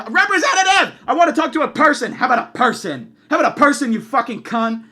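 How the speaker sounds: high, loud enraged outburst; re-enacted furious shouting; screaming into phone. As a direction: yell demands at the phone operator. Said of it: "I'm screaming on the".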